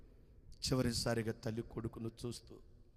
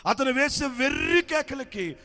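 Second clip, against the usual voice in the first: loud frantic narration; 12 semitones higher, 10 dB louder, slower than usual. A narrator's reading.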